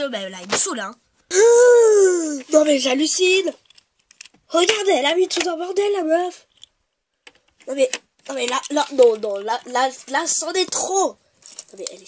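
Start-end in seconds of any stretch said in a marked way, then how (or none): 1.3-2.5 high, loud cry of outrage; loud shocked gasp. Pretend. Doyenne gasping loudly at the messy room. 2.5-11.1 louder indignant ranting; mock outrage. Doyenne ranting about the mess in the room.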